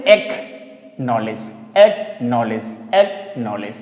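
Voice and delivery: एक्नॉलेज drawn out slowly, no c sound; slow careful model. Shown as a demonstration. Teacher slowly modelling acknowledge for students, deliberate. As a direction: stretch एक्नॉलेज slowly and skip the c. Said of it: "इसको पढ़ेंगे क्या".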